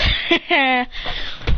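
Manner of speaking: short mock hero chuckle; acted chuckle. Hero as the fireball disappears, acted chuckle. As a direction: put on a brief laugh.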